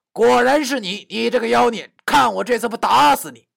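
loud, high, threatening; furious high-pitched threat; angry cursing. Acted. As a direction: curse the demon at full voice. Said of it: "边骂道".

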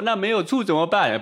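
hammed-up tearful pleading; mock crying plea. Teacher mimicking a friend at the door begging for vinegar, mock crying. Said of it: "哭着脸跟你说".

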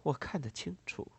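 quiet, restrained contempt; contemptuous. A narrator's reading. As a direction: say it softly with cool disdain. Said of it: "带着一种轻蔑的语气说道". (轻蔑而克制地低声说)

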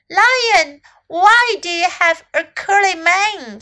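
lightly voiced high, loud question; curious little elephant. A narrator's reading. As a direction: call out and ask like a curious little one.

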